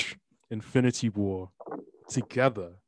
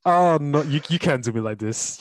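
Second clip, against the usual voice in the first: loud, higher mock dismay; mock outrage; 4 semitones higher, 7 dB louder than usual. Real, not acted. Host reacting to Philip's jab, loud mock dismay. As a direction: cry out in hammed-up shock.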